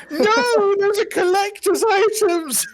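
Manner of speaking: high, loud mock-outraged wail; hammed-up cry of a horrified fan; upset collector. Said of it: "Vote Saxton or whoever's gonna watch this very upset".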